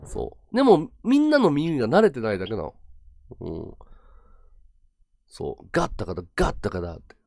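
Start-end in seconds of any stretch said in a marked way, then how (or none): 5.7-7.0 ガッタカダ low, "go" opened to "ga"; low ガッタカダ, final "d" of God swallowed; slurred native-style GOD. Shown as a demonstration. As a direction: run ガッタカダ together as one word. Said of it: "英語の発音がゴッドがガッってなる".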